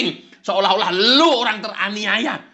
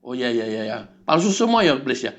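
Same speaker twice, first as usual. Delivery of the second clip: meek low yes-man; 8 semitones lower than usual. Acted. Follower meekly gives in to the devil.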